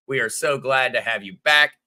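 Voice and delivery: ordinary talk, nothing marked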